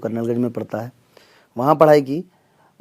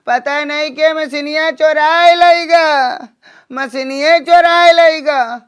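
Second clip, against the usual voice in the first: high, loud, drawn-out deaf auntie voice; बहिरा चाची; 9 semitones higher, 4 dB louder, slower than usual. Acted. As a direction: play a hard-of-hearing village aunt, pitched way up and complaining. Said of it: "तो मिलिए बहिरा चाची से". (ऊँची आवाज़ में बहरी चाची का अभिनय)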